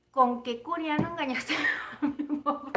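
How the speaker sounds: mock accusation breaking into laughter; teasing through laughter. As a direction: ask it teasingly, cracking up mid-line. Teasing her mother, breaks into laughter. (acusación en broma, entre risas)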